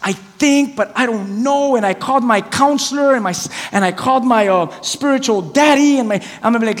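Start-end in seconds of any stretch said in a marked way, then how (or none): 0.0-1.8 higher, wavering over the answer; mock uncertainty. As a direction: act unsure, drawing out the doubt. Acts someone unsure about God's call.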